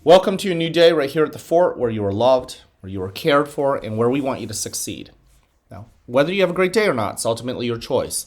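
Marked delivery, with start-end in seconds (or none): 5.7-8.2 fast, brisk announcement; quick first take. Acted. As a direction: rattle off the message quickly. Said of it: "I was talking about this".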